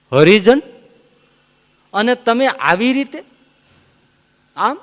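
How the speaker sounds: high, loud incredulous question; disbelief, loud; astonished. Retold or imitated.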